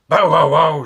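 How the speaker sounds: loud barks; dog barking. Acted. Bitzer barks at the tree, loud. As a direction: bark like a big dog. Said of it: "said Bilter Maloney".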